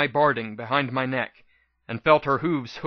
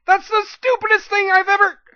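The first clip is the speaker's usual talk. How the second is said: furious scream; 6 dB louder than usual. Acted. Screaming argument continues.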